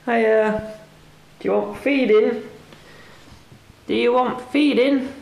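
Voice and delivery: high, coaxing singsong to a cat; pet talk. Real, not acted. Owner asks the hungry cat about feeding, in pet talk.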